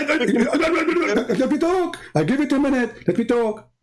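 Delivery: high, insistent, half laughing; exasperated demand. Real, not acted. Cut off by the opponent, he insists on his turn to talk. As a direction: push hard to get the floor.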